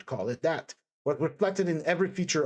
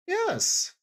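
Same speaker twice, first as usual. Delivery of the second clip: abrupt. A narrator's reading. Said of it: "Sonia whispered abruptly".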